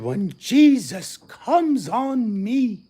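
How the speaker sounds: high, very slow, drawn-out preacher intoning; mock the preacher's solemn delivery, stretching each word; hammed-up pulpit voice, high and slow; imitating a preacher. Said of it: "He said".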